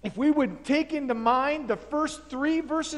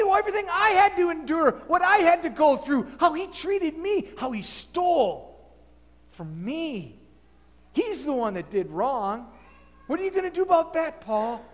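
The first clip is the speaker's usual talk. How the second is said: heated rant; 5 semitones higher than usual. Acted. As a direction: pour out the grudge. Philemon rants at Paul.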